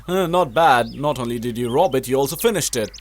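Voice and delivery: ordinary delivery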